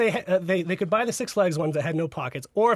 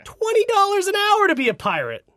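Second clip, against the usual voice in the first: pitched up, amazed at the pay; excited; 12 semitones higher, 3 dB louder than usual. Real, not acted. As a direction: sell the big number with glee.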